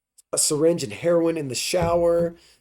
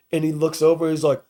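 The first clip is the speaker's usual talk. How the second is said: normal talking voice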